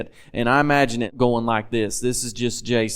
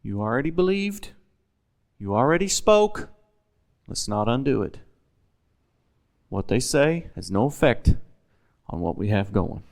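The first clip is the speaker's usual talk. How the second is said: gentle encouragement; 2 semitones lower, 3 dB quieter than usual. Retold or imitated. Jesus voiced encouraging Jairus.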